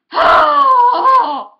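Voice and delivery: long loud exasperated groan, pitch jumping high; loud frustrated groan. Real, not acted. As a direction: let out a big fed-up groan. Upset about new TV couple.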